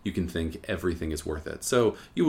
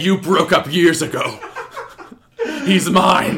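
high, loud, laughing possessive claim; laughing mock jealousy; 12 semitones higher, 10 dB louder, slower than usual. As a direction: claim him through giggles.

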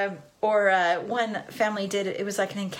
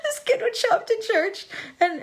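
ordinary talk, nothing marked

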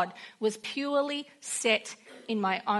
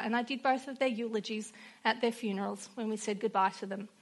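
ordinary talk

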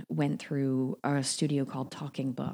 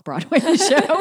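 laughing; 12 semitones higher, 13 dB louder, slower than usual. Real, not acted. Laughs at the tiny show.

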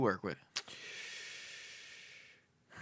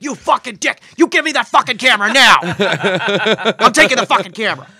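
high-pitched, very loud screaming rant; furious yelling; 10 semitones higher, 16 dB louder than usual. Retold or imitated. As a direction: scream at him like a raging director. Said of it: "He loved to yell at people".

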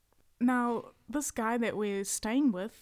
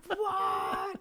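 high, shouted disbelieving what; shocked what; 10 semitones higher than usual. Retold or imitated. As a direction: yell it like you just got pranked, pitched high. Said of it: "He was like".

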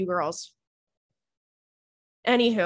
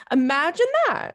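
raised, sarcastic "imagine that"; mock disbelief; 7 semitones higher, 3 dB louder than usual. Mocking the bare minimum text.

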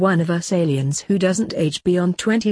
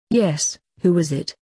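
ordinary delivery, nothing marked

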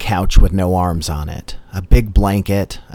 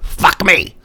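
higher mock possessed shriek; possessed girl scream; 9 semitones higher than usual. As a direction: pitch it up like a demon girl. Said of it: "Reagan screaming".

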